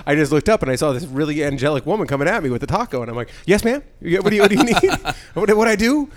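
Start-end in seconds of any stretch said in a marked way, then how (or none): 3.5-6.1 high, loud, acted startled politeness; mock flustered reply. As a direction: answer as if caught off guard, pitched up. Acted startled answer to angelic woman.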